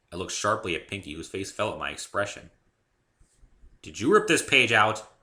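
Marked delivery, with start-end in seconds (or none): 3.8-5.1 louder, accusing edge; stern question. A narrator's reading. Dave confronting the pony about the torn page, stern. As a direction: demand an answer sharply. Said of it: "in perhaps a sterner tone".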